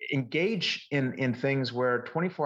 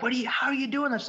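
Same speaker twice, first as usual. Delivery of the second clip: imitating astonishment, much higher pitch; retold incredulous question, pitched way up; baffled husband; 12 semitones higher than usual. Husband baffled by wife's success, retold.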